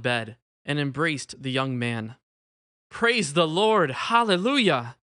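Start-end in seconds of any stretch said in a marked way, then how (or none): 2.9-5.0 loud, high, drawn-out cry of praise; slow, exultant shout; joyful shout. A narrator's reading. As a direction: shout it out in jubilation. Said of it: "Antonio shouted".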